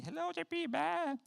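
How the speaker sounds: high bleating sheep voice. Retold or imitated. Preacher imitating the morning sheep, high bleat. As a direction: bleat it like a sheep greeting you.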